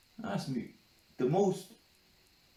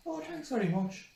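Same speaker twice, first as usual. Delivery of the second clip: high offended thanks; 13 semitones higher than usual. Retold or imitated. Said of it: "I go".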